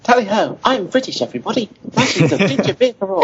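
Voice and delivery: high-pitched, hammed-up British voice; over-the-top British caricature, pitched well up; sexy British accent. Shown as a demonstration. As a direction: play up a jolly tally-ho British accent. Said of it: "I did that voice a lot recently".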